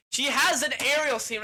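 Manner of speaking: ordinary talk, nothing marked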